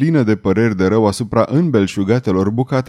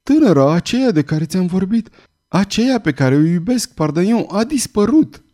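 tearful; 8 semitones higher than usual. A narrator's reading. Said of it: "ochi se umpluseră de lacrimi".

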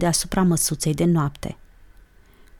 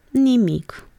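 quick, higher-pitched brush-off; quick reply; 6 semitones higher than usual. A narrator's reading. Olguța answering her startled friend, quickly. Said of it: "răspunse iute Olguța".

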